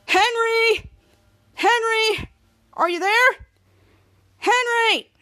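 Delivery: high, drawn-out search call. Acted. Kid Danger searching school halls for Henry, calling out. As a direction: call out for someone missing. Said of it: "I'll try calling him again".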